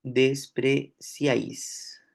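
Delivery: despreciáis drawn out slowly with the stress landing on the open á; teacher sounding out despreciáis as a contrast example; slow demo word. Shown as a demonstration. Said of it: "En despreciáis no podríamos decir lo mismo".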